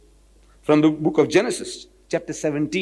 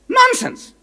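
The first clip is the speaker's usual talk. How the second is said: scornful dismissal. Real, not acted.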